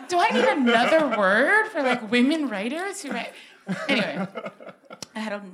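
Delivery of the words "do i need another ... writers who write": loud, high, indignant question; hammed-up indignation, pitched up; mock outrage. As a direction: protest loudly for comic effect. Mock outrage at being taken for male.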